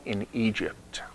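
unvoiced hushed aside; whispered in Egypt. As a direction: breathe the words out. Preacher drops to a hush.

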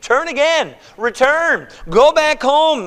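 high, emphatic string of commands; insistent urging. Acted. Naomi keeps sending her daughters-in-law home, insistently. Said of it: "How many times does she say this?".

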